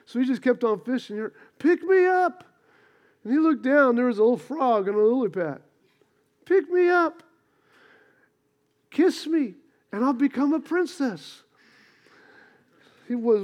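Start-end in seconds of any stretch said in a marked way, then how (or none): 1.6-2.4 high, squeaky little frog calling out. Retold or imitated. Frog voice in joke. 6.4-11.4 high, squeaky little frog calling out. Retold or imitated. Frog voice in joke.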